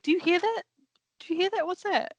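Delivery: high mocking squeak of fake fear; mock scared ghost hunter. Podcaster mocking the ghost hunters, high mock fright. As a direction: pitch it up like a jumpy ghost hunter. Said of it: "be like".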